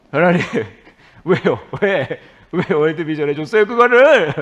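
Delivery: loud whining demand climbing high; resentful shouting. Acted. Preacher blaming God for favouring World Vision, resentful shout. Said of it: "계속 하나님을 원망했죠".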